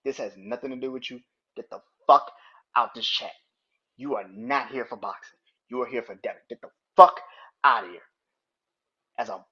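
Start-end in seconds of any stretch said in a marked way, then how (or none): 1.6-3.3 loud, angry order to get out; angry shout. Real, not acted. Ranting streamer shouts at Devin fans to leave chat, angry. 6.5-8.0 much louder, top-of-the-lungs shout; furious yell. Real, not acted. Fed-up streamer screams at Devin fans to get out, furious.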